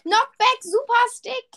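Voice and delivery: high, loud gleeful shout; excited gamer. Real, not acted. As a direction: cheer the item. Finds weapon, cheering.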